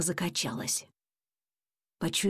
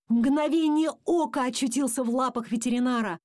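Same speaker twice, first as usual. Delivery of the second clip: ordinary delivery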